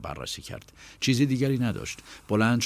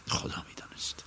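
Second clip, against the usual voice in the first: whisper; 9 dB quieter than usual. A narrator's reading. Narrator's musing aside.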